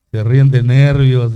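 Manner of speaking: booming, drawn-out quote of the pastor; mock pastor quote thrown at the room; loud slow quote. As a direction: call it out loud and slow to the congregation. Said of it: "Dice el pastor".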